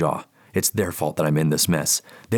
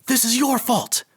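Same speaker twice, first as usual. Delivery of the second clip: much higher furious scream. Acted. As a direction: scream the accusation. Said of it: "I'm screaming now".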